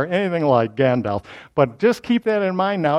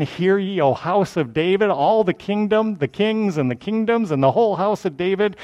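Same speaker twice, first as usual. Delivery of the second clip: higher, formal prophet's summons; herald's list on a raised pitch; authoritative proclamation; 4 semitones higher than usual. Acted. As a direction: proclaim it with authority to the whole dynasty. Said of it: "his proclamation".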